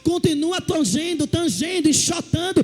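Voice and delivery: rally the congregation; sermon shout building; fervent preaching. Real, not acted. Preaching at full force.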